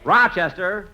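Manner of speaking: loud call up the stairs. Acted. Jack calling his sleeping valet to get up, loud call. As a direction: shout his name to wake him.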